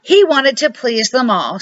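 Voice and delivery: usual speaking voice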